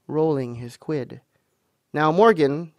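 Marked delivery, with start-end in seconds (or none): 1.9-2.7 louder, stern address. A narrator's reading. Landlord begins questioning Morgan, very stern. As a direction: call him to account. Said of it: "said Long John very sternly".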